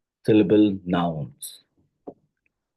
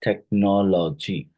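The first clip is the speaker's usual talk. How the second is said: "technology" slowly, each syllable distinct. Shown as a demonstration. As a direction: stretch "technology" so its syllables can be counted. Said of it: "how many syllable does".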